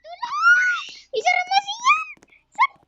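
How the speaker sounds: high terrified shout for help; panicked screaming. Real, not acted. Chased by aswang teacher.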